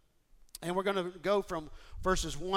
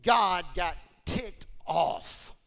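loud, very slow, every word hit; preacher warning of God's anger, emphatic; 4 dB louder, much slower than usual. Real, not acted. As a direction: slam it down word by word.

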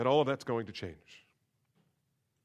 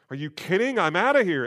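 louder, higher mock disbelief; a mildly hammed-up huff of disgust; indignant outburst; 5 semitones higher, 7 dB louder than usual. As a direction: throw up your hands and storm off. Disciples leaving at hard saying.

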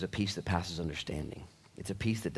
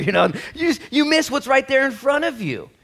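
normal talking voice

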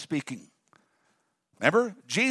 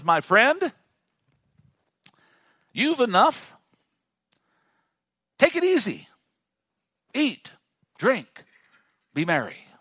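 higher, smug take-it-easy voice; self-satisfied rich man; 4 semitones higher than usual. Acted. Preacher voicing the rich man telling himself to relax, smug. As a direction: coax yourself to relax, voice up.

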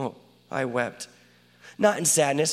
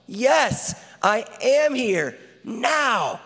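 fervent declaration; 8 semitones higher, 4 dB louder, much slower than usual. Acted. God declares his presence.

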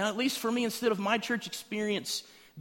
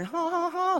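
high, held "ha" notes; hammed-up high vocalizing; mock singing; 12 semitones higher than usual. Churchgoer loves coming to sing songs, mock singing high.

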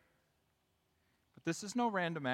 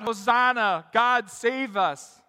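raised, loud cry to God; shouted plea; 6 semitones higher, 10 dB louder, slower than usual. Acted. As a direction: call out as a worshipper pleading for rescue. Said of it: "to shout".

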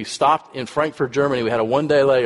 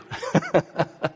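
soft laugh; 5 semitones higher, 4 dB quieter than usual. Real, not acted. Laugh after her kindness.

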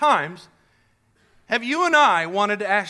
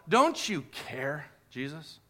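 hurt quiet plea; 7 semitones lower, 6 dB quieter, slower than usual. Acted.

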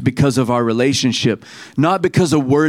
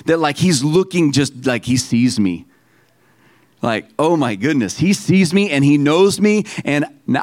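ordinary talk

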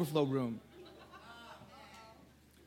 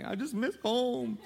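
put-on higher and slow, sad small child; homesick little girl; 7 semitones higher, slower than usual. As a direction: sigh it out like a tired kid. Daughter's homesick reply voiced.

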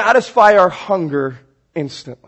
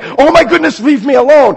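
high, loud acted exasperation; exasperated father; 6 semitones higher, 6 dB louder than usual. Dad answering nagging kids in the car, exasperated. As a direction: burst out like a harried parent.

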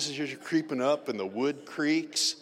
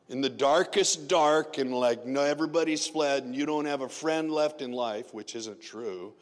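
normal talking voice

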